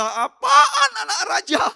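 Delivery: very high, loud, drawn-out jeer; mocking jeer. Preacher imitating outsiders who scoff at believers, a shrieked jeer. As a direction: stretch it into a loud mocking cry. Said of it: "kalau mereka mencibir kita".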